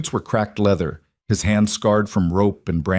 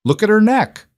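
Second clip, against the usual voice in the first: very high, loud cry of alarm; shouting from the crowd; 13 semitones higher, 6 dB louder than usual. A narrator's reading. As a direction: yell it from the back of the crowd. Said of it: "someone shouted from the back".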